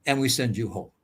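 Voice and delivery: menacing officer. Retold or imitated. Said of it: "He said".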